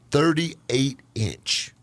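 very slow, stretched-out words; drawn out. Real, not acted. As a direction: stretch each word while recalling it. Slowly recalling a measurement.

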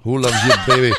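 a hint of Kojak through a laugh; mock Kojak line, laughing; laughing Kojak impression. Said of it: "That's Kojak".

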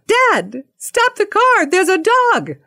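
put-on high-pitched kid's cry of alarm; youngest child yelling out. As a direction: imitate an urgent child shrieking from the back. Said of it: "the youngest yells out".

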